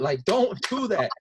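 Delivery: loud, slow scolding; angry dad telling off. Retold or imitated. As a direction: lay down the law.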